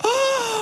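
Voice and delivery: a sharp, high imitated gasp of shock. Retelling how Tiff reacted, a high mock gasp. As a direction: gasp high like the stunned girlfriend. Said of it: "and then Tiff's like".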